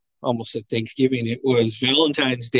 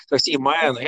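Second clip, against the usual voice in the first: ordinary delivery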